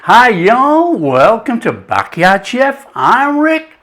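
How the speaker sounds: a hammed-up Southern host welcome; Southern accent. Host welcomes viewers to Backyard Chef in a Southern accent. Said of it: "that's enough of the Southern accent".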